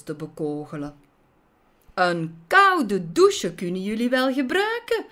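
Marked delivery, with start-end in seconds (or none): 1.9-5.0 high, loud playful taunt; gleeful mocking shout from the heroine; merry call. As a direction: call out teasingly, as if amused. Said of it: "riep ze lachend".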